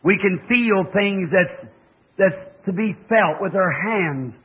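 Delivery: slow, reverent prayer voice. Acted. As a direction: pray over the congregation earnestly. Leads closing prayer.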